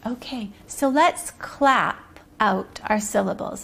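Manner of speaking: ordinary delivery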